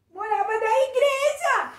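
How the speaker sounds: high, loud exclamation of wonder; wide-eyed storyteller surprise; amazed. Acted. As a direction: react with big astonishment for a child. Amazed reaction in children's story.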